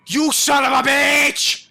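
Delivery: loud, slow, high yell; angry shout. Real, not acted. Player ambushed again, yelling in rage. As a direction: roar the curse at the ambusher.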